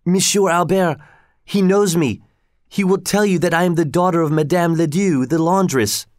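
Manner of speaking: high-pitched, urgent plea from a frightened woman; narrated cry for help, pitched well up; crying out in distress. A narrator's reading. Arrested woman begging an acquaintance to vouch for her, crying out.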